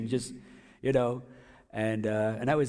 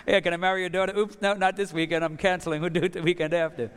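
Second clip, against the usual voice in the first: higher, louder, breezy mock apology; a hammed-up oops, higher and louder; mock sheepish cancel; 6 semitones higher, 4 dB louder than usual. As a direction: play it up, brushing off the proposal lightly. Mock call to fiancée's parents.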